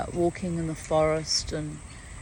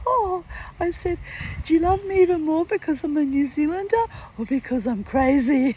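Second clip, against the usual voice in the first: playful squeal; 11 semitones higher, 5 dB louder than usual. Retold or imitated. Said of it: "And I went".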